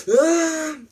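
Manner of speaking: high, loud acted yell; short theatrical attack cry; battle yell. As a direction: cry out as if lunging.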